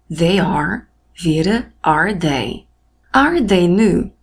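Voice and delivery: slow model English. Shown as a demonstration. Teacher modelling English example.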